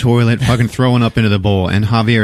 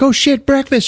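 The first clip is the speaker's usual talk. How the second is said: high-pitched acted panic; mock alarm. Wife hears the smoke alarm go off, mock alarmed. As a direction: yelp it as a sudden realization.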